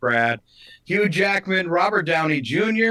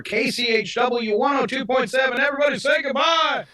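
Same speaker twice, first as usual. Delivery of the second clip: announcer shout; 8 semitones higher than usual. Real, not acted. Radio sign-off announcement.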